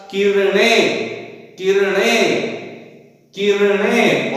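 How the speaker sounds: किरणें slowly, with retroflex ण; model pronunciation of किरणें. Shown as a demonstration. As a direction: say "ki-ra-ṇeṅ" for pupils to repeat, each syllable held. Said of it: "आप बोलिए मेरे साथ".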